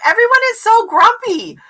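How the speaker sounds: high, loud mock complaint; retold exasperated complaint. Retelling Sydney's complaint about the show, mock exasperation. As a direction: exclaim it pitched right up, like a fed-up viewer. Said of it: "and she's like".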